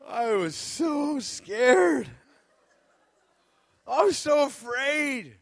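high, loud, slow cry of remembered fear; scared outcry. Retold or imitated. Preacher reliving the jump to the church, high slow outcry. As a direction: stretch it out like you're still shaken. Recalling terror of skydive.

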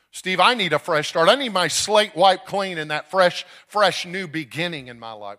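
loud, high pleading cry; urgent plea. Retold or imitated. Congregant telling Steve of needing a fresh start, urgent plea. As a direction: plead at full force for another chance.

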